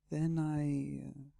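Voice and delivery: trailing off. Acted. She breaks off recounting the rescue, trailing off. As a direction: voice held up, then left hanging. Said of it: "she trailed off".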